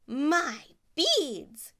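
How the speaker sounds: outraged snatching; indignant cry. Acted. Stepsister to Cinderella, loud outraged cry. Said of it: "cried one stepsister".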